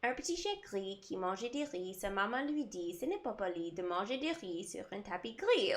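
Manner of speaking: soft, running French rhyme recited from memory; memorised French recitation. Shown as a demonstration. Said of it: "So it goes".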